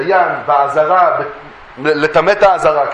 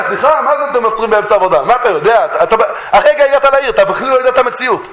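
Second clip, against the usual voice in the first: higher, rushed mock outrage; indignant retort; 4 semitones higher, faster than usual. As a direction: protest like an offended worker told off by a stranger. Acted retort of indignant shepherds. (מחאה נעלבת ומהירה, בהצגה)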